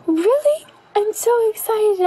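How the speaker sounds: gasping, high-pitched delight; excited gasp. Acted. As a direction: squeal it out with joy. Girl learns of coming baby.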